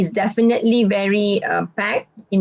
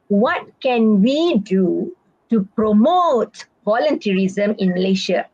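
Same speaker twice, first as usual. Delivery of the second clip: slow, deliberate question with "we" and "promote" stretched; stress on promote; slower than usual. Shown as a demonstration. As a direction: draw out "promote" for the listener.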